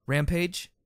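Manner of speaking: anxious high call; worried call. A narrator's reading. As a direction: call her name urgently. Narrator calls to wounded friend.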